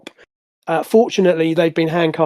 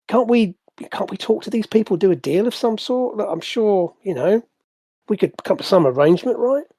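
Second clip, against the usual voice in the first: higher-pitched, whiny pleading; flustered protest; 5 semitones higher than usual. Acted. Jailed hireling begs his cellmate to make a deal, flustered. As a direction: plead your case, stumbling over the words.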